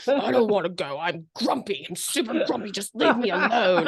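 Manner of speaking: put-on grouchy old-man dog voice; Scout. Owner voicing her dog refusing to go, grumpy old-man voice. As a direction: grumble it as a grumpy old soul. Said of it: "Scout's always like".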